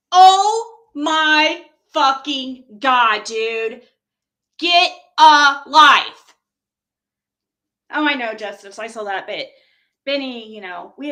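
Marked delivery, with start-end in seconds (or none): 0.1-6.3 very loud, slow, drawn-out outrage; furious exasperation. Real, not acted. Fed up with a stalker's obsession, loudly exasperated. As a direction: stretch each word and hit it hard.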